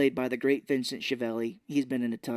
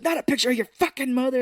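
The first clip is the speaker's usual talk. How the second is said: high, fast, louder imitation; mock angry rant; 10 semitones higher, 5 dB louder, faster than usual. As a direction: fire it off as a raised retold outburst. Retells ranting gunman.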